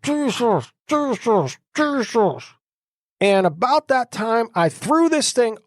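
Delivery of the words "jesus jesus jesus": pushed-out high repeated name through a half-open mouth; struggling to speak. Retold or imitated. Paralyzed man forces out the name with straining high repeats. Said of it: "where I could say it a little bit".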